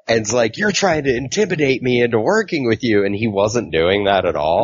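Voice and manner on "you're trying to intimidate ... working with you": a mild imitation of a higher, accusing outburst; half-mocking her sudden high-pitched anger; lightly voiced, shrill-leaning accusation; irrationally angry. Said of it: "she gets irrationally angry".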